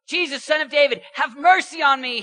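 high, very loud cry to Jesus; screamed plea. Retold or imitated. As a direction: scream it out like a beggar desperate to be heard. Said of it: "he screamed".

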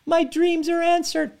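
put-on a child-like inner part thrilled its dream came true; giddy inner part. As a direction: voice the abandonment part squealing with glee. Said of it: "The fear of abandonment parts of you are like".

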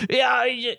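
high, strained mock-dad complaint; grumpy anti-dog dad. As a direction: put on a whining dad who hates dogs. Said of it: "my dad was like".

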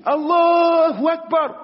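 high, loud, slow scream of Allahu Akbar; screamed takbir. Retold or imitated. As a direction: shout it to the sky. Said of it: "they were screaming".

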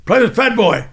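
loud drill sergeant call; mock drill sergeant shout; sergeant barking. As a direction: bark the name like a drill instructor. Drill sergeant calling recruit.

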